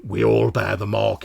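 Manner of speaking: loud, emphatic reminder; forceful, declamatory brute leader; commanding mutant leader. Acted. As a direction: assert your authority over the tribe. Voices Ulva, the tribe leader.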